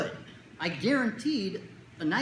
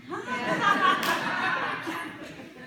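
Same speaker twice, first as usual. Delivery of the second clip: high laugh; 14 semitones higher, 4 dB louder than usual. Retold or imitated. Kid asks again.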